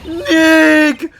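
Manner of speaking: high, drawn-out yell of NICK; loud shout. Acted. Nick testing the camera with his name, yelling. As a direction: yell the name at full volume. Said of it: "not saying it loud enough".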